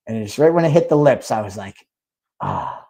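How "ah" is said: hammed-up tender cooing to a can, louder and higher; mock loving reunion. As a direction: welcome back a long-lost love, overdone. Greeting the Monster can like a lover.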